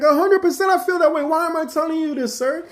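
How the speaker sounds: very high, loud and fast insistence; emphatic insistence. Retold or imitated. Insisting at manager, peak of argument.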